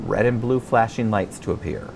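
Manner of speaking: plain reading voice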